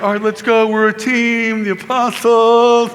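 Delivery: loud, high hype-up, last word stretched; rallying the team. Retold or imitated. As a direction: whip up the team like a mock cheerleader. Peter rallying the others.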